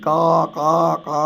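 high, loud cawing like a crow; crow's caw. Retold or imitated. Teacher imitating the crow calling out loudly, high cawing. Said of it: "കാക്ക ഉറക്കെ ഒച്ച ഉണ്ടാക്കി".

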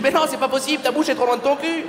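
high, know-it-all TV explainer voice; voix aiguë de présentateur qui explique; Jamie from C'est pas sorcier. Retold or imitated. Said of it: "C'est pas sorcier avec Jamie qui te dit".

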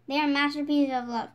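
ordinary delivery, nothing marked

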